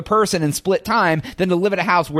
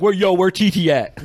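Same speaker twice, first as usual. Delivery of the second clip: lightly put-on street accent, calling around; street slang accent. Joking with his cohost, mimicking a guy calling out in street accent. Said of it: "Why are you using that accent?".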